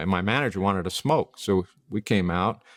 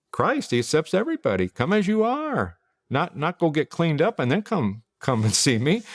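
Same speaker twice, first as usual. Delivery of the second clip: much higher, bright welcoming invitation; friendly pastor; 7 semitones higher than usual. Retold or imitated. Pastor welcoming her outside the church, cheerful call. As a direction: beam at a stranger and call them in.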